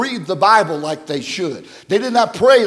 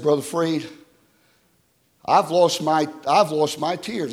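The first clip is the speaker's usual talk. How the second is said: ordinary delivery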